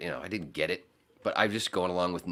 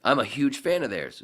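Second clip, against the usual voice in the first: normal talking voice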